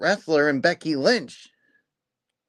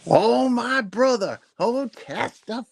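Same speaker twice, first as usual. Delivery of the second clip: churchy praise; 7 semitones higher, slower than usual. Real, not acted. Hyping up cohost like preacher.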